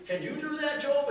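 high, loud climax of the challenge; booming challenge. Retold or imitated. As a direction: hurl the question down at Job. God challenging Job.

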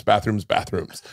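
fading announcer echo; echoing show title. Shown as a demonstration. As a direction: let the title echo away like a TV promo. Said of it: "show called Bathrooms".

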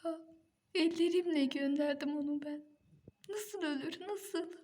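crying in anguish; wailing grief. Acted. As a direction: howl it out through tears. Grieving wife wailing.

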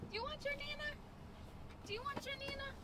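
very high, soft sing-song offer; high hushed coaxing question. Real, not acted. Offering to a toddler.

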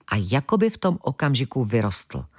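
usual speaking voice

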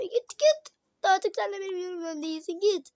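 high, loud play-acted rejection; pretend tearful outburst. Child sending someone away, mock tearful anger. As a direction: cry out as if banishing someone, in play.

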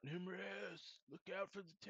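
high, softened pretend shout; hushed mock yell. Blue warns Nimrus about the gator's tail, high muted mock yell. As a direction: call a warning with the volume held down. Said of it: "And I'm yelling out".

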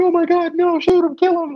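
very high mock scream; high-pitched, hammed-up crowd yell; imitated audience screaming. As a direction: squeal it like a worked-up moviegoer. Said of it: "like, screaming".

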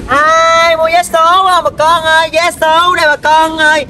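high, slow, drawn-out hawking cry; vendor's street call. Acted. Lottery ticket seller calling to everyone on the street, hawking. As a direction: stretch it out to reach passers-by.

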